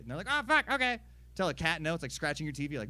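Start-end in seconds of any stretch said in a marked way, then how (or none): 0.3-1.0 high, loud yelp of compliance; mock startled dog. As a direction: give in like a scolded dog. Dog's startled reply to scolding.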